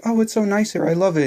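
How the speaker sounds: hammed-up tourist delight; gushing mock Audrey. Mocking Audrey arriving on the island. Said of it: "she's like".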